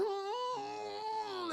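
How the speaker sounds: high muffled cry through tape; gagged muffled whimper. Retold or imitated. Gagged sister whimpering, high and muffled. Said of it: "duct tape over her mouth going".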